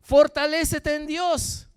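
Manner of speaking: loud, high, slow command; loud exhortation. Real, not acted. Pastor urging an anguished believer to strengthen himself, loud exhortation.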